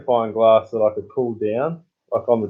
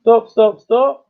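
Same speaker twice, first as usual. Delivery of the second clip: calling stop; 10 semitones higher, 4 dB louder, slower than usual. Real, not acted. Halting the number wheel.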